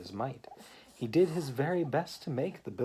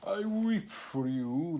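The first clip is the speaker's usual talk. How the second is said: mock sorrow; 6 semitones higher, slower than usual. Walrus fake sympathy.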